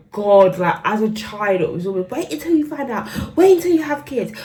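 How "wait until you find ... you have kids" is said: high, loud, nagging mimicry of mum breaking into laughter; mocking mother. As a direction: parrot mum's nagging in a shrill sing-song.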